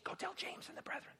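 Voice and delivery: hushed whisper; whispered instruction. Acted. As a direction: pass on the message in a whisper.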